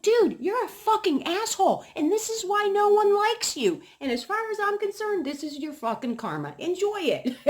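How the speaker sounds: very high, loud furious telling-off; retold angry rant. Telling off the Mexican neighbor, high loud furious yell. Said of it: "I'm like".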